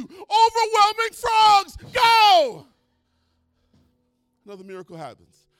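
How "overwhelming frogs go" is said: slow, very loud high shout; God commanding. Acted. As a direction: unleash the plague. God unleashes frogs.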